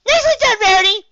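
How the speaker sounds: very high, loud excited cheer; high loud excited call. A narrator's reading. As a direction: shout her praise across the ring. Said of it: "Pinkie called".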